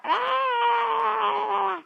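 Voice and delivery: a sudden high shriek of fright; startled scream. Acted. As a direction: scream as the rat appears. Farmer sees the rat.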